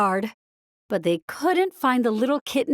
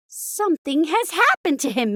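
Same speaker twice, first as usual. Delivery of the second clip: Minnie crying out; 5 semitones higher than usual. A narrator's reading. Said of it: "Minnie cried".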